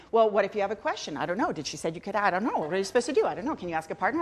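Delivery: rapid-fire fretting to oneself; anxious head chatter. Retold or imitated. Student's inner voice during silent work, mock-anxious rapid chatter. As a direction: rattle off nervous questions, barely pausing. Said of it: "my little head chatter would start going".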